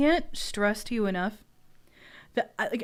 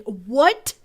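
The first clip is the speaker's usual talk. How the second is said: retold shocked text; 3 semitones higher, 6 dB louder, slower than usual. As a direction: act out the frantic message to friends. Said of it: "text my friends and be like".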